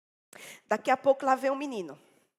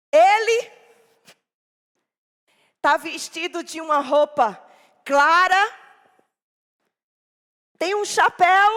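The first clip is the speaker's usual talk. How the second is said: put-on high, loud childish telling; child describing; 6 semitones higher, 12 dB louder than usual. Boy describing the man to his mother, high and loud. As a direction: tell it like an eager little boy.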